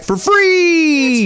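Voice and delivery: high, drawn-out salesman flourish; pitchman's gleeful sing-out; hyped ad offer. Pretend. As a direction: stretch it out high like a game-show prize. Hyped ad offer, co-host echoes it.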